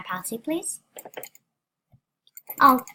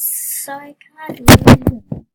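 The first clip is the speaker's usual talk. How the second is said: frustrated shout; 2 semitones higher, 18 dB louder than usual. Real, not acted. Broken mouse, frustrated.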